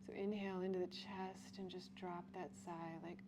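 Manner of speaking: soft, unhurried breathwork guidance; guided breathwork. Acted. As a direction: guide the class gently into the stretch. Leads breathing and relaxation.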